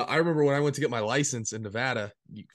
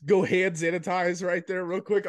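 normal talking voice

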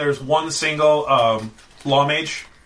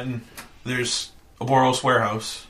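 ordinary talk, nothing marked

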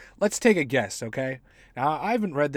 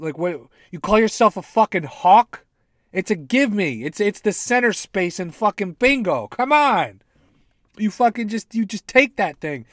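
mock outrage; 6 semitones higher, 8 dB louder than usual. Real, not acted. Ranting at the hawk.

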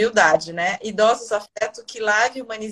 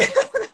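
nervous laugh; 3 dB louder than usual. Real, not acted. Overwhelmed host laughs.

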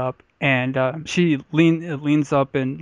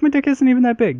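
brash retort; 10 semitones higher, 5 dB louder than usual. Retold or imitated. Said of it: "I'm like".

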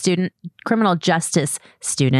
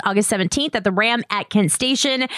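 ordinary talk, nothing marked

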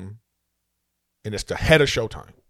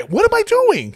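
exasperated; 7 dB louder, slower than usual. Retold or imitated. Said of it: "same words repeated aloud".

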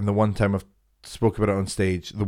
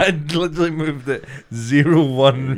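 laughing defence; giggling through the words; 5 semitones higher, 4 dB louder than usual. Real, not acted. Laughs while defending himself.

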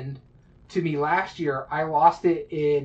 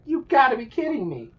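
exasperated; 9 semitones higher, 3 dB louder than usual. Retold or imitated. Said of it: "So I was like".

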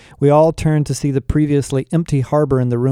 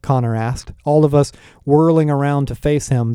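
ordinary delivery

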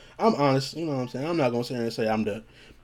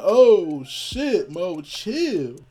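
high, drawn-out comic panic; mock alarm; 6 semitones higher, 5 dB louder, much slower than usual. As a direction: plead in exaggerated alarm. Retold fear of her anger.

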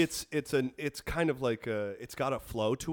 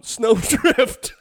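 laughing; 6 semitones higher, 10 dB louder than usual. Real, not acted. Rhyme game, laughing.

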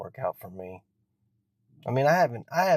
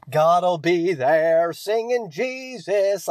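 higher, louder, slow sing-song preacher parody; drawn-out churchy sing-song, sneering; mocking preacher; 8 semitones higher, 7 dB louder, much slower than usual. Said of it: "stupid fucking bullshit preacher".

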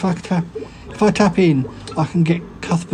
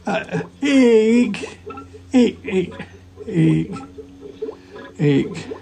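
calling out; 4 semitones higher, much slower than usual. Acted. Calling out for Ig while tiny.

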